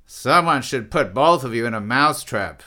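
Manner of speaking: loud sneering jibe; Gould. A narrator's reading. Gould scolds both quarrelling men, sneering. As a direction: sneer it at the pair of them. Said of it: "sneered Gould".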